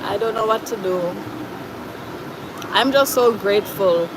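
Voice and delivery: usual speaking voice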